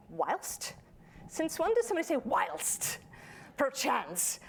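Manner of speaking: high, scoffing American ear sneering at whilst; an American scoffing at British words; mocking American listener. To British-English writers, a mock American scoff. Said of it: "an American ear won't go".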